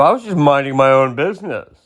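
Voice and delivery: mock innocent whine; victim bully voice. Mocking bullies who play the victim. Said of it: "where they go".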